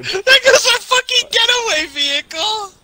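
half laughing, half crying out. Real, not acted. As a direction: wail it through a laugh. Car destroyed in game.

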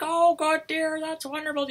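piercingly high, loud falsetto housewife; Monty Python woman. Retold or imitated. Ogre's wife praises his catch, Python-woman falsetto. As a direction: squeal it like a man playing the wife.

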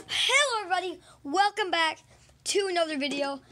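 high, loud, hyped host greeting; big excited welcome to the viewers; video intro. Pretend. Host opening a video for viewers, high and loud.

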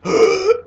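sharp, very high choking gasp; startled gasp. Retold or imitated. Old woman's breath stolen mid-fight, a sharp gasp. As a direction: suck in air as if suddenly choked. Enyaba suddenly can't breathe.

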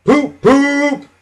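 high, loud train-whistle poop; engine whistle call. A narrator's reading. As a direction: toot like a big proud engine. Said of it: "never forgets to say".

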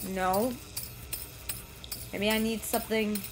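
usual speaking voice

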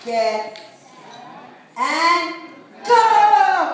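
starter's call rising to a shout; race start countdown. Shown as a demonstration. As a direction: count them off, then fire the start. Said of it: "how you said start contests".

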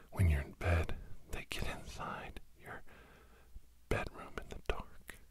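ordinary delivery

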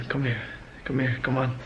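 ordinary talk

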